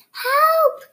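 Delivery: high, loud cry for help; cried help. A narrator's reading. Witch calling for help while fleeing the dragon, loud high cry. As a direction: scream it out. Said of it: "cried the witch".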